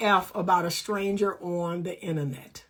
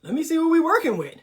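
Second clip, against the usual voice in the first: interested flirt; 4 semitones higher, 4 dB louder, faster than usual. Pretend. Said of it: "I'm like".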